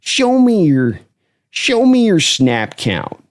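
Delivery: demanding coach, slow on the last phrase; mock-stern coach quiz; Gruden impression. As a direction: challenge the rookie to prove it. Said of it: "watching him chop up these kids".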